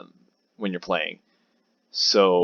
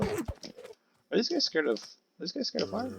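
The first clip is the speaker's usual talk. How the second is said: silly high voice; 11 semitones higher, 11 dB quieter, slower than usual. Pretend. Musing about mobs, odd voice.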